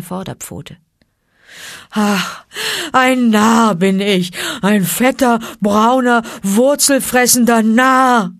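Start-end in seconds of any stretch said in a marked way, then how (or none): 1.9-8.3 loud, slow self-scolding call; crying out. A narrator's reading. Baloo crying out at himself, loud and slow. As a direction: shout at himself. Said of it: "rief Baloo".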